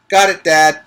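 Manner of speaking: put-on loud, keen kid's answer; boy answers his dad loudly and eagerly; eager reply. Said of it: "Harrison replied".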